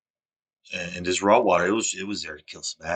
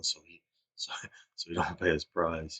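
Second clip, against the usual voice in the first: whisper; 2 semitones lower, 8 dB quieter than usual. Real, not acted. Aside about the man's motive.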